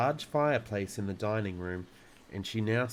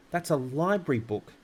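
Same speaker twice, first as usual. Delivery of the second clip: louder, higher cry of protest; 5 semitones higher, 4 dB louder than usual. A narrator's reading. Matilda protests to father tearing her book, crying out. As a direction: cry out, upset about the book. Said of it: "Matilda cried".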